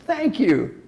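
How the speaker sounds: high, sincere thank-you; grateful thanks. Shown as a demonstration. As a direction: thank them gladly. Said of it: "what are you gonna say to this person".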